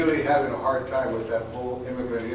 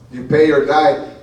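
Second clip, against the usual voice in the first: menacing shout; 3 semitones higher, 13 dB louder, slower than usual. Acted. Gang threat in poem.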